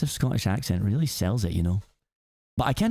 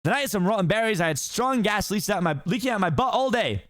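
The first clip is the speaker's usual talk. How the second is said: high fast-talking donkey voice; excited Donkey; 9 semitones higher than usual. Acted. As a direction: gush admiringly at the ogre. Donkey keeps chattering.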